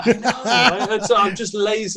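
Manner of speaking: chuckling through the words; laughing. Real, not acted. Guest laughing about his own hair. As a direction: laugh while owning up.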